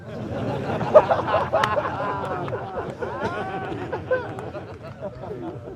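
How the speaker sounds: long high wheezing laugh; helpless laughter. Real, not acted. Storyteller cracks up at the man's blurted words, helpless laughter.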